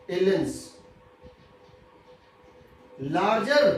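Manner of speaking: very slow, drawn-out classroom dictation. Real, not acted. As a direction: dictate word by word with long pauses. Teacher dictating notes word by word.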